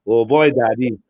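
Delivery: put-on Bajan accent; playful Bajan impression. Shown as a demonstration. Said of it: "That's actually good".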